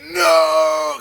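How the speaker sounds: high, loud, drawn-out "no"; hammed-up cry of disappointment; mock dismayed yell. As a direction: wail it long and high, exaggerated. Retold childhood dismay at empty box.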